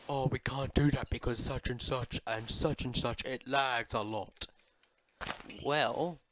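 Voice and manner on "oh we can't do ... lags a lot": complaining commenters. Retold or imitated. Player quoting commenters who object to changes, mocking complainer voice. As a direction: imitate people whining in the comments. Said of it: "comments from people saying, um".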